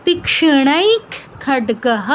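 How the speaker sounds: তীক্ষ্ণৈক খড্গহ very slowly, first word closed on kh; তীক্ষ্ণৈক ends in kh, খড্গহ in full "ha"; visarga as kh. Shown as a demonstration. Teacher modelling for learners, slow তীক্ষ্ণৈক ending in kh. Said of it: "ক্ষয় হসন্ত".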